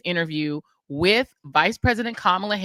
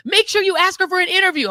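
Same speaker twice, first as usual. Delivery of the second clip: high, louder mock urging; imitating friend Adrian; 12 semitones higher, 7 dB louder than usual. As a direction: push it up high and loud like a producer nudging. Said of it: "Adrian ... is like".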